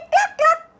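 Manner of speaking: playful hen voice; hen cluck. Shown as a demonstration. Hen sound in picture book.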